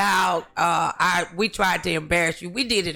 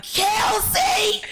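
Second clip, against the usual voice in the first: high-pitched scandalized cry of the name; retold shock, name called out high; shocked exclamation; 14 semitones higher than usual. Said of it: "And I was like".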